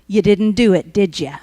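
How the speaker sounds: a sneering, gloating question, dropping low at the end; lightly mock the doubter's gloating; mocking taunt. Stranger phoning to gloat, low mocking taunt. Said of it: "That's the devil".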